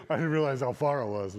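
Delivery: usual speaking voice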